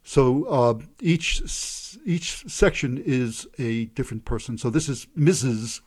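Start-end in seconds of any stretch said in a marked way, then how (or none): none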